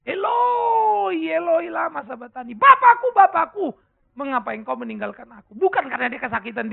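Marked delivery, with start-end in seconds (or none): 0.0-5.5 crying out high and loud in anguish; a sobbing shout to the Father. Retold or imitated. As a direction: wail it like a forsaken son. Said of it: "Dan dia berteriak".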